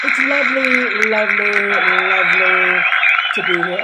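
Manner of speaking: loud, hammed-up Irish host voice; mock Irish lilt. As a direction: gush it out like a stage Irishman. Pretends to greet adoring crowd.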